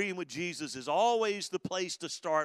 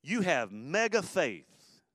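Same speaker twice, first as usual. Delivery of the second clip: loud woman, then slow you have mega faith; a ringing, drawn-out commendation; Jesus praising; 6 semitones higher, 5 dB louder, slower than usual. Retold or imitated. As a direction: call her out, then linger on mega. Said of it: "What Jesus was saying to this lady is".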